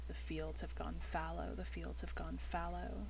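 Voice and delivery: soft, haunting refrain; echoing phrase. A narrator's reading. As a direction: repeat it hushed, like an echo in the mind. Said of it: "you'll hear a phrase echoing back and forth".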